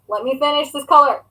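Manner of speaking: normal talking voice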